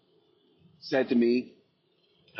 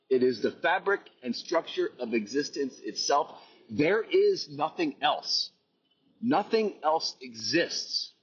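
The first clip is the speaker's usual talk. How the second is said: higher, emphatic preaching with weighty pauses; fervent proclamation; 5 semitones higher than usual. Real, not acted. Telling listeners this sensation is life, fervent proclamation. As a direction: proclaim it with total conviction, drawing out key words.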